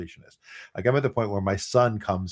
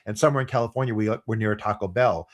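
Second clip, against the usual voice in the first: ordinary talk, nothing marked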